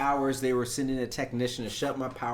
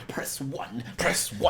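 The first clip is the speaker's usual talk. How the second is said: breathless scammer; 2 semitones higher, slower than usual. Retold or imitated. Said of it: "Why is he out of breath?".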